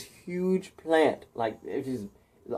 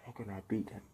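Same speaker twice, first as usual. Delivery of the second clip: hushed, much softer; worried whisper; 5 semitones lower, 19 dB quieter, faster than usual. A narrator's reading. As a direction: mutter it to yourself. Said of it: "and he's like".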